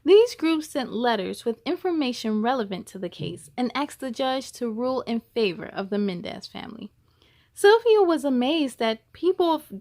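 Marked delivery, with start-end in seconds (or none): none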